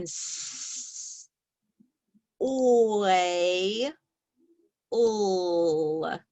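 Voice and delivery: sounds drawn out one by one; stretched sounds. Shown as a demonstration. Teacher sounding out soil for the class, drawn out. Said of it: "Sound it again".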